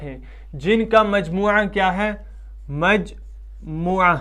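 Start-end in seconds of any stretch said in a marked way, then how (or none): 2.7-4.2 very slow, syllable by syllable; drawn-out term. Shown as a demonstration. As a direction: stretch مجموعہ out in two slow parts. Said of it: "مجموعہ کہا جاتا ہے".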